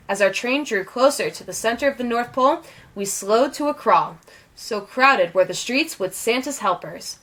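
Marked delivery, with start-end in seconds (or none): none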